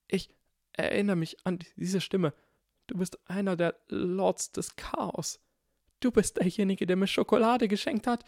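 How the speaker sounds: put-on high, rising frightened boy; frightened recognition. A narrator's reading. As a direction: gasp it out in alarm. Neville gasps, recognizing the voice.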